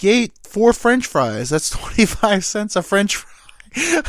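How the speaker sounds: high, loud rant breaking into laughter; mock angry yelling. Buddy billing her for stolen fries, acted outrage with laughs. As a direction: act outraged, cracking up midway. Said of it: "so mad that he got ye-, they yelled it".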